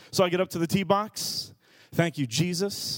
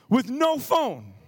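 usual speaking voice

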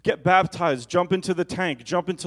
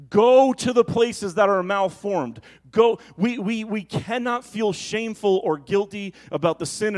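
full-force preaching; 5 semitones higher, 6 dB louder than usual. Acted. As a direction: call them out to the wilderness. Altar-call exhortation, loud and slow.

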